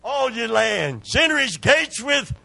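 high, loud preaching to the congregation; full-force preaching. Acted. Preacher rousing the church to praise, loud and high. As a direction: build the crowd up and drive it home.

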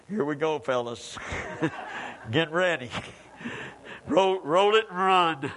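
low, hushed aside with chuckles; wary workmen. Retold or imitated. Men at the tomb warning one another, wary muttering. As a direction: mutter it to your fellows, half laughing. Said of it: "I could just hear 'em talking to one another".

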